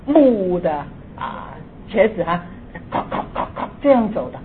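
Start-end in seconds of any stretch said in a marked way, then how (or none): 2.9-3.5 soft unvoiced clicking mimicking clogs on the street; whispered clip-clop of wooden shoes; wooden clog clicks. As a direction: click out the footsteps softly. Imitating wooden clog footsteps.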